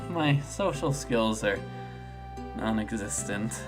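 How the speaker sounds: sigh, then sink low and soft; deflated, trailing into a low murmur; quiet, halting embarrassment; self-deprecating dejection. Acted. Voices a shy character despairing.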